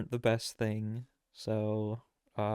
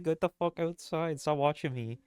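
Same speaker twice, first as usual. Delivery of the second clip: mock yell; 6 semitones higher than usual. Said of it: "he said".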